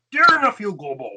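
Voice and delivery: loud, high puppet character voice; high-pitched letter opening in character; Uncle Matt. Acted. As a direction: put on a cartoon uncle voice. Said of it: "What about Uncle Matt?".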